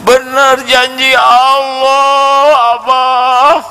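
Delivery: put-on high, slow, moved child declaring; a boy overwhelmed by answered prayer; emotional child voice. Boy telling his father God's promise came true, high slow moved voice.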